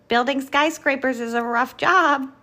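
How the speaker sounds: lightly voiced blustering cement mixer; clattering cement mixer. A narrator's reading. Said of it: "clattered Cement Mixer".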